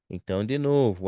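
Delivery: normal talking voice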